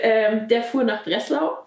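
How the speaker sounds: usual speaking voice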